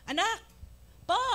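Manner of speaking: put-on mother calling her child over the radio, loud; mock shout over music. (pasigaw na tawag ng ina)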